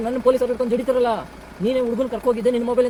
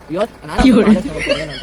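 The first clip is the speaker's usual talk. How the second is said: loud laughing threat; 8 dB louder than usual. Acted.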